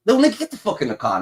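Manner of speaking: usual speaking voice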